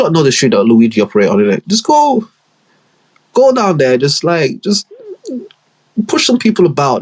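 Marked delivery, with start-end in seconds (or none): 1.7-7.0 higher mocking order voice; mock high boss voice. Boss sending his boys out, high mock voice. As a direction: bark orders in a squeaky mock voice.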